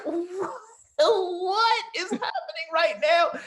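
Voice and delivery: high, loud cry of disbelief; "what?" shrieked high and loud in shock. Retold or imitated. Man called an Englishman reacts in stunned disbelief. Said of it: "And I was laughs like".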